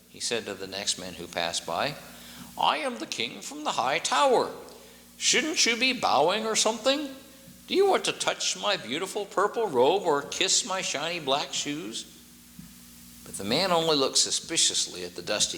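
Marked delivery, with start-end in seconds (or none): 2.6-12.0 high, haughty royal voice; a vain king fishing for bows; pompous king. A narrator's reading. Vain king demands a passerby bow, high and haughty.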